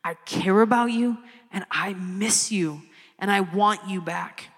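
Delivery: tender voice of God. Acted. God calling the lost child home, tender and soft. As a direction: speak softly as a longing father. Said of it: "he's saying to you".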